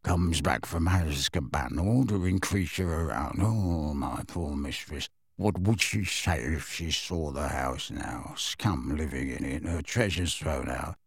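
low, soft, bitter grumble; muttering. A narrator's reading. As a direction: grumble to himself while shuffling out. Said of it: "he muttered all the way out".

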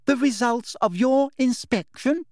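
high, over-enunciated condescension; patronising inspector, word by word; loud, slow voice. A narrator's reading. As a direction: spell it out as if to someone dim. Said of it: "speaking once more in that loud, slow voice".

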